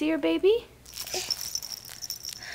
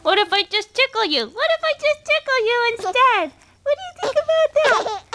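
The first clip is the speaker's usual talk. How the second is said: playful singsong; 6 semitones higher than usual. Real, not acted. Parent playfully threatens tickling.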